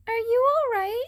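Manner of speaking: put-on high, bright young hero voice, concerned; cat boy voice. A narrator's reading. Cat boy checks on fallen Shinso, high and kind. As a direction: ask gently from up high.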